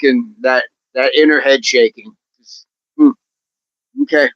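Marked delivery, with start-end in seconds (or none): 2.4-3.1 high, loud hmm of confusion; a squeaky baffled hmm; mock confused hmm; puzzled hum. Imitating head shaking.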